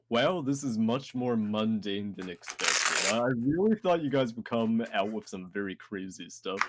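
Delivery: ordinary delivery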